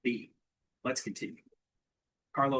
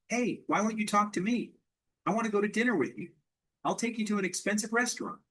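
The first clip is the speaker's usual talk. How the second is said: mock yell; 8 semitones higher than usual. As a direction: act out calling after someone, pitched high. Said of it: "He yells again".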